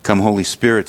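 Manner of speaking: ordinary talk, nothing marked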